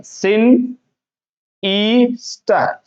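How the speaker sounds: "सिन, ई, स्टर" in separate pieces; slow syllable-by-syllable teaching of "सिनिस्टर"; split into parts. Shown as a demonstration. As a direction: stretch each part of sinister with gaps. Teacher breaks word into parts.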